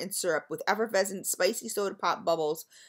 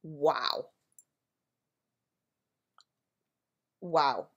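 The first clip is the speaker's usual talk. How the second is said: a low, hushed wow of real amazement; awed wow; 6 semitones lower, 3 dB quieter than usual. Real, not acted. Vlogger smelling the lemon cookie wax, awed. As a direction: let the wow slip out, stunned. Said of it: "Okay, I'm just wowing".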